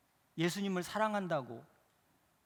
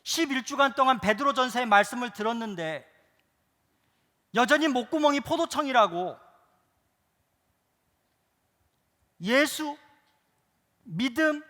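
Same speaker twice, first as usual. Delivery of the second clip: high, loud rebuking sermon; fiery preaching; 7 semitones higher, 10 dB louder than usual. Real, not acted. Pastor rebuking the congregation, loud and fiery.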